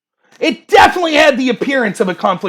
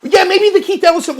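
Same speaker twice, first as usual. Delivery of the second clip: ordinary delivery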